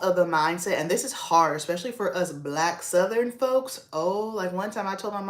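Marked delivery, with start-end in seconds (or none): none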